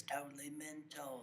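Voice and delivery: put-on playful British accent, laughing through it; mock British accent. Friend consoling someone called mental, jokey British accent. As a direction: toss it off as a joking Brit. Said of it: "Well, in Britain, that's a good thing".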